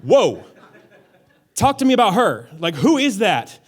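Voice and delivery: loud, high, bowled-over exclamation; hammed-up, loud love-at-first-sight reaction; smitten amazement. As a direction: blurt it out, struck by someone across the field. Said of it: "he's like".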